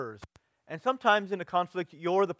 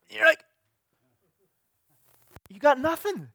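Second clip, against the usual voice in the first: exasperated; 13 semitones higher, 9 dB louder than usual. Retold or imitated. Frustrated arguer, voice jumps up.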